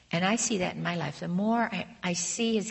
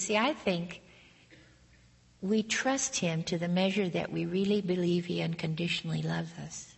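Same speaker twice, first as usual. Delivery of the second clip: usual speaking voice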